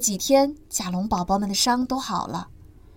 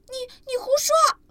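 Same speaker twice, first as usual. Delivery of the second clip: much higher little voice, stumbling; indignant tiny dinosaur; a sibling crying foul; stammering baby protest; 13 semitones higher, 3 dB louder than usual. Acted.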